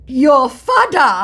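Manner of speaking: slow, loud, high Nigerian pidgin drawl on "fada"; mock Nigerian pidgin. Scammer taunting her in Nigerian pidgin, slow and loud. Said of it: "I don't speak pidgin".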